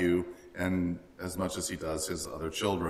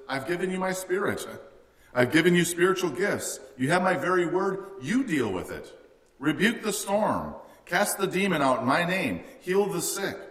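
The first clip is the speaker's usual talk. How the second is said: higher, stern and commanding; firm Jesus; 7 semitones higher than usual. Retold or imitated. Preacher voicing Jesus to praying believers, firm command. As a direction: hand the power back to them, firmly. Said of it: "his response is".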